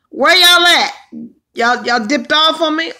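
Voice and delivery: loud, high call to the chat. Real, not acted. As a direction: shout it out to the viewers: where y'all at. Calling out to absent viewers.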